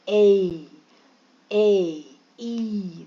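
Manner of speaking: ए and ई each held long on a falling tune; long vowel sounds. Shown as a demonstration. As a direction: stretch ए, ई slowly, one vowel at a time. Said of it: "long vowel sounds है".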